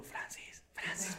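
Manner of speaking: hushed whisper to wake someone; careful, unvoiced-sounding whisper; whispered call. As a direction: whisper the name so nobody else hears. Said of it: "para no hacer ruido".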